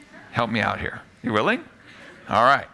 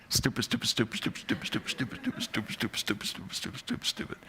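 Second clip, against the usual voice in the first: rapid, fading to a whisper; fast repetition; 3 semitones lower, 9 dB quieter, faster than usual. Retold or imitated. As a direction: rattle it off until it falls away. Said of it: "really fast said out loud".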